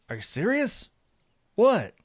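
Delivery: high, rising disbelief then a loud "What?"; surprised. A narrator's reading. Saphir learning of a gift made for him, surprised. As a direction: react to an unexpected gift.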